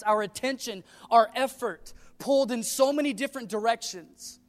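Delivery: loud, high, emphatic preacher delivery; full-force preaching. Real, not acted. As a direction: preach it out to the whole congregation.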